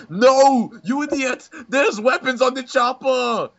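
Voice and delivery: high, loud, slow hammed-up shout; mock Arnold yell. Friend retelling Arnold scolding the soldiers, mock yell. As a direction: yell it like a furious action hero. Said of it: "He says".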